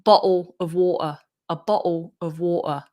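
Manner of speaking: southern London accent, glottal T in "Bottle"; London glottal stops for each T. Shown as a demonstration. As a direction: drop the T in "Bottle" and "water" like a Londoner. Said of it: "like, London accent".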